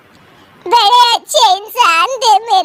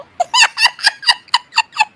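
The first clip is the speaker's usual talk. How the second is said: high uncontrollable cackle; long, rattling high-pitched laugh; laughing fit. Acted. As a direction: burst out laughing helplessly. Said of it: "फिर से हंसने लगती है".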